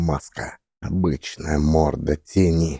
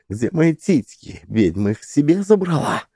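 angry outburst; 9 semitones higher than usual. Acted. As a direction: rage at the witch. Heroine raging at loss.

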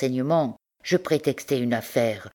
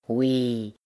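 plain reading voice

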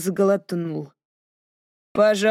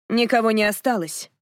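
plain reading voice

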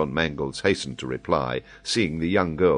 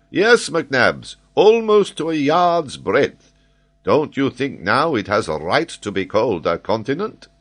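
high, louder, lively professor voice; an excitable scholar holding forth; high, animated geographer explaining; Paganel, eager learned Frenchman; 9 semitones higher, 7 dB louder than usual. Acted.

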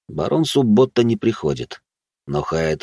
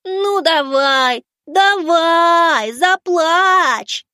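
very high, drawn-out taunting whine; slow, squeaky mock crying voice; mock whimpering; 3 dB louder, much slower than usual. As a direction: whine like a baby to goad a child. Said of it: "захныкал я".

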